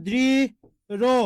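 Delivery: দৃঢ় drawn out in two parts, "dri... ro"; syllable-by-syllable দৃঢ়. Shown as a demonstration. Teacher showing students what it will be, slow split দৃঢ়. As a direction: stretch দৃঢ় slowly, pausing between syllables. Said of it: "তাহলে কি হবে?".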